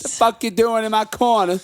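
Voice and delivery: very high, loud put-on Cockney; hammed-up pub tough; loud, high mock Cockney.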